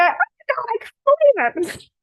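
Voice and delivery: high, loud mock dismay; exasperated disbelief. An owner whose dog wees right after the walk, in high mock dismay. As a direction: cry out in comic disbelief. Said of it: "And then you go".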